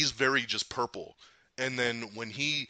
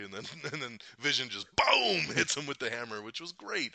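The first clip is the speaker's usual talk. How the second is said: ordinary talk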